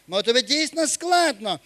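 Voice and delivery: ordinary delivery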